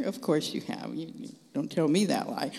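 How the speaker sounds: ordinary talk, nothing marked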